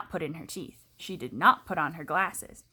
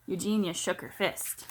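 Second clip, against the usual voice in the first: usual speaking voice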